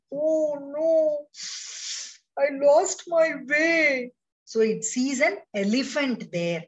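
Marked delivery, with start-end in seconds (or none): 0.1-4.1 high, upset little voice; a lost bee wailing to itself; crying little bee. Acted. Lost bee cries to herself, high and upset. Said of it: "So it has started crying".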